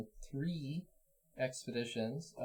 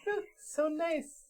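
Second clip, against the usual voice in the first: much higher cutesy cooing; cooing baby talk; slower than usual. Pretend. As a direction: coo at it like a pet. Cooing at the cute donkeys.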